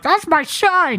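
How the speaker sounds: a hammed-up mom; mock mom. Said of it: "And she's like".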